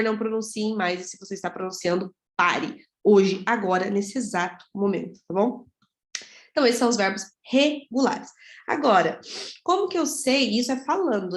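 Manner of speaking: ordinary talk, nothing marked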